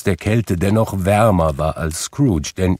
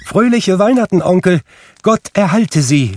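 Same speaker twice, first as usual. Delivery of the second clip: bright, much higher, louder call of joy; cheerful greeting; 12 semitones higher, 6 dB louder than usual. A narrator's reading. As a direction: burst in with a happy festive shout. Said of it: "rief da eine heitere Stimme". (fröhlicher, heller Weihnachtsgruß)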